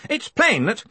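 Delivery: high-pitched indignant flow; righteous indignation. A narrator's reading. Stoley oblivious to the hush, high indignation. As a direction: carry on indignantly until cut off. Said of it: "in his own little world of righteous indignation".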